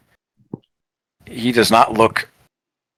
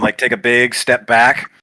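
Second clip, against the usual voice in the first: ordinary delivery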